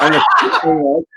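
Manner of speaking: high and loud through laughter; cracking up mid-sentence; laughing. Real, not acted. Telling the hosts the punchline, laughing hard.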